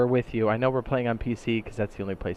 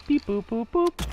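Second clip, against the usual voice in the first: high robotic beeps; beep boop sound effect; 12 semitones higher, slower than usual. Pretend. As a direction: chirp it like a cartoon machine. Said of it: "let me flash the logo".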